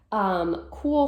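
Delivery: plain reading voice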